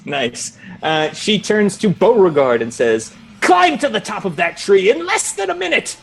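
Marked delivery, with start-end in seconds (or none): 3.4-6.0 high-pitched imperious order; commanding examiner. Acted. Examiner setting Beauregard a timed climb, commanding. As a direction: issue the challenge from high up in your range.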